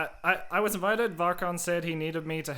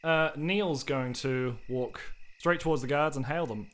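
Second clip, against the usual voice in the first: ordinary talk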